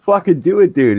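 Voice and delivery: high, loud, gleeful urging; retold egging on. Dad retelling how he urged his son to shoot teammates, excited. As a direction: goad him on with voice jumping up.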